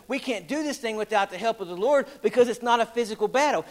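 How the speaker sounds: ordinary talk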